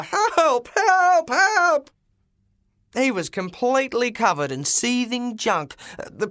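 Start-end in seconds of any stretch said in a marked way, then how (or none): 0.0-1.9 very high, loud panicked screams; frantic repeated yells. Acted. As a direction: scream for help again and again, buried in junk. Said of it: "yelled the driver".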